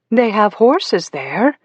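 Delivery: high, slow, syrupy sing-song; sickly sing-song. Acted. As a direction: coax sweetly, much too sweet. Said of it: "in a sickly, sing-song voice".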